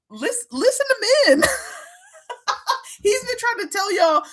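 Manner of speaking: ordinary talk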